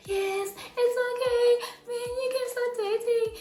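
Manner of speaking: high, tearful mock sob of agreement; mock crying. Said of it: "Now I start crying like".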